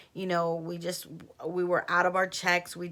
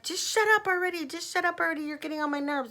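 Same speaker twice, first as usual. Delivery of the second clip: exasperated snap; 10 semitones higher, faster than usual. Retold or imitated. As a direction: tell her off, fed up with her. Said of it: "my friend's like".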